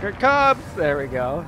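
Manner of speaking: loud, high excited yell. Real, not acted. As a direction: call out the coming danger. Bracing for the hazard.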